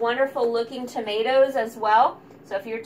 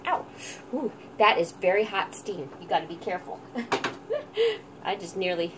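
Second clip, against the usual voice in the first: ordinary delivery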